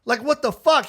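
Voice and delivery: high, heated mock outrage; incredulous complaint. Host confronts the producer after a killer set, high heated disbelief. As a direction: demand an answer in high-pitched disbelief. [name] keeps protesting to Bob.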